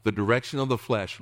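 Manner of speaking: ordinary talk, nothing marked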